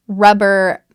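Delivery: ordinary delivery, nothing marked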